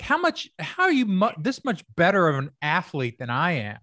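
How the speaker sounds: starts very high, then settles; amazed disbelief. Retold or imitated. Marveling beside star athletes in disbelief. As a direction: gasp out the question in wonder.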